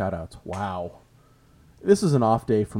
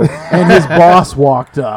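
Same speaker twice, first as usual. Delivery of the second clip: laughing; 5 semitones higher, 11 dB louder, much slower than usual. Real, not acted. Laughing while telling the story.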